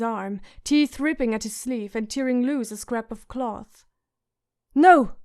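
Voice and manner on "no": sharp loud cry of No; boy shouting. Acted. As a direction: yell it out to stop the wolf. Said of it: "Bran shouted".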